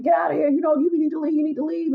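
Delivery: ordering out. Retold or imitated. Man ordering woman out.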